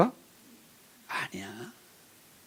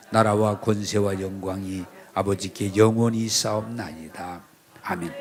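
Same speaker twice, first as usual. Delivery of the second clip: congregational recitation; 6 semitones lower than usual. Real, not acted. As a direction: recite solemnly in a low measured chant.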